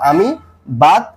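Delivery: slow, with gaps between words; piece-by-piece assembly. Shown as a demonstration. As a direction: separate each part as if building the sentence. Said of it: "যেটা মিলে এটা যোগ করবে". (ধীরে, টুকরো টুকরো করে জোড়া)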